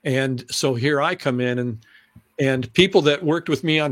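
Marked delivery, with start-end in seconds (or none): none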